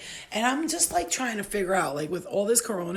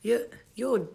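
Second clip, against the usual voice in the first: usual speaking voice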